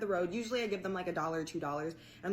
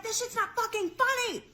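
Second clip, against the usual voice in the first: much higher, snapping mock rage; retold anger; 4 dB louder than usual. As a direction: scold the laughing kid, pitched up. Snapping at laughing boy.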